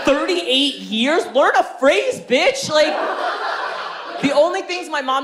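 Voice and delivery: high, rising into a shout; exasperated rant. Real, not acted. Comic ranting at mom about her English, high exasperated yell. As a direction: build from disbelief to a yelled insult.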